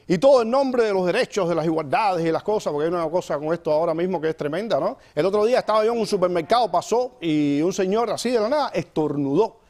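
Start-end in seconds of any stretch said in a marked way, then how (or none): none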